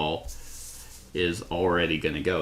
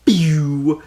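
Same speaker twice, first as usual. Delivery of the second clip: high, loud "pew" zap; playful blaster sound effect; laser blast noise; 7 semitones higher, 7 dB louder than usual. Pretend. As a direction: mimic a beam firing. Imitates repulsor beam firing.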